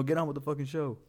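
high-pitched hurry-up heckle; playful exasperated demand; mock impatience. Mock impatience with the slow playback.

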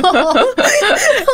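bursts out in a high, loud laugh; a sudden high laugh of amusement. Real, not acted. Mother laughs at child's defiant quote. (高声大笑)

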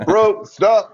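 high, loud bro stop; mock exasperated shout. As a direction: cut him off, loud and high. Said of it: "And I'm all".

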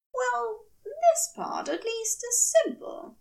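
high, bright proclamation; declaring angel. Acted. Angel declaring to his partner, high and grand. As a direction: announce it grandly. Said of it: "he declared".

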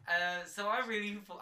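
ordinary delivery

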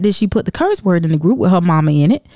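ordinary delivery

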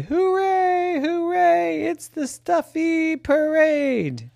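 high, slow cheering crowd. Acted. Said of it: "watched and cheered and say".

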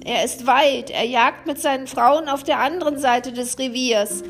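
calling vulture. Acted. The vulture calls down to the helpless friends, croaking. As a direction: croak down from the tree like a kindly vulture. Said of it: "rief ihnen zu".

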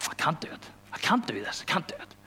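whispered, defeated; hushed despair. Retold or imitated. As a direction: breathe it out like giving up. Retold despair, unvoiced.

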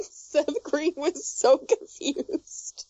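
ordinary talk

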